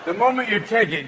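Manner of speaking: ordinary delivery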